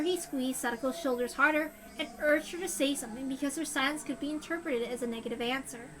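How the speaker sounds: usual speaking voice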